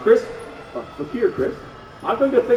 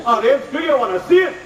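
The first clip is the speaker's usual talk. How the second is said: raised, rousing host call; calling out to crowd; 8 semitones higher, 4 dB louder than usual. Acted. Host asking the audience for a cheer, calling out. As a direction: work up the crowd.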